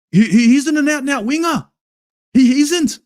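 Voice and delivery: shouted high in frustration; exasperated shout. Real, not acted. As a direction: yell it out, fed up with the tactics. Fan ranting about tactics.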